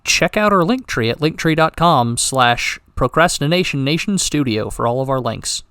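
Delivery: announcer read. Acted. Outro plug.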